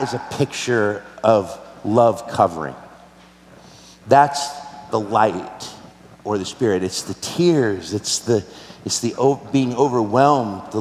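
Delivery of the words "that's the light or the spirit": normal talking voice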